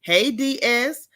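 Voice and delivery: usual speaking voice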